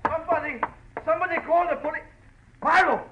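high, loud, frantic yelling; desperate screaming call for help; panicked cry for help. Acted. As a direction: shout in terror for someone to come. Panicked man calls for help.

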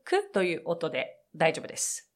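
usual speaking voice

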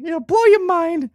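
hammed-up slow falsetto; silly falsetto. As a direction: sell it like a giddy cartoon salesman. Echoing falsetto voice.

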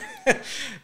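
a high, broken laugh on the edge of tears; pained laugh. Real, not acted. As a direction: laugh that cracks into grief. Breaking down recalling father's death.

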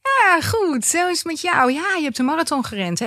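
high, bright mock enthusiasm; overeager greeting. Retelling greeting a stranger he can't place, with overdone cheer. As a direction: fake delight at meeting an old friend. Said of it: "En ik zo".

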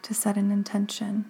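unhurried meditation guide, long pauses between phrases; measured, calming delivery with room to breathe; guided meditation. Acted. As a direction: guide the listener gently into stillness, phrase by phrase.

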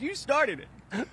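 slow, drawn-out childish mock "you started it"; imitating his daughter. Dad on air quoting his daughter, mock sulky child voice. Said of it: "As my, as my daughter says".

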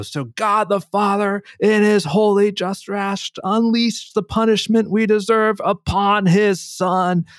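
high, slow mock preacher yelling; imitated sermon shout over a banged bucket; retold preacher scream. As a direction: ham up a camp preacher's shout of wrath. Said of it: "as he's screaming".

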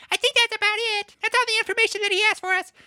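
high, rapid character voice; squeaky Henley voice. Retold or imitated.

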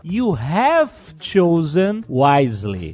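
slow, solemn film quote; measured imitation of the ancient guardian. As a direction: deliver a weighty verdict, unhurried. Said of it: "ele fala".

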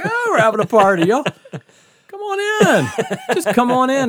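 high, loud party-host holler; calling out welcome. Retold or imitated. Host to a porch full of guests, hollering.

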